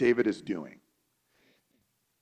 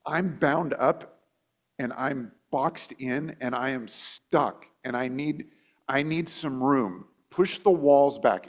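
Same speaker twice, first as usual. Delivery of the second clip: slow, deliberate pleading; slower than usual. Retold or imitated. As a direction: stretch out each word as if trapped. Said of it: "what the phrase literally means is".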